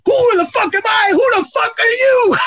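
very high, loud, screeching mock shout; high shrill yell. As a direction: yell like a shrill furious ballplayer. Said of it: "Kenny Lofton starts yelling".

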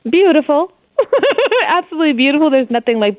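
high, delighted and glowing; overjoyed new mother; gushing joy. Real, not acted. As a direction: let the joy spill over. Gushing about adoption.

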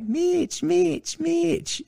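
very high, teasing mimicry of a kid; mock the kid's squeaky calls; mocking imitation. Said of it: "like, making fun of me".

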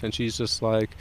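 normal talking voice

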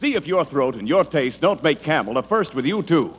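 normal talking voice

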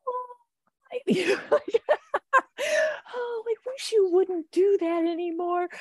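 very high, whimpering plea to a naughty dog; mock sad plea to dog. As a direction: beg the dog in a high crying voice. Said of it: "and you're like".

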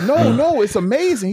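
high, loud retold protest; enthusiastic insistence. Gym guy talks up powerlifting to sceptic, insistent. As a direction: sell it high and eager.

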